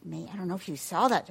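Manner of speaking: plain reading voice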